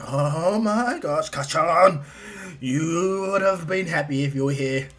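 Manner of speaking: slow, drawn-out awestruck gushing; Izuku. Acted. Boy wishing his friend were here, slow awestruck gush. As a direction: stretch every word in fanboy wonder. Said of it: "He's like".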